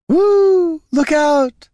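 loud, very high whoop; wild high-pitched party shout; called out. A narrator's reading. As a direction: whoop and holler a warning. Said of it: "called an elf".

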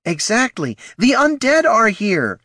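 angry exclamation. A narrator's reading. Gnoll shouting at girl.

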